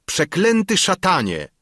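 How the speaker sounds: loud, high-pitched curse; furious cry. A narrator's reading. Enraged Edmund can bear it no longer, furious cry. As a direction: burst out in rage. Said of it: "szalonym gniewem przejęty".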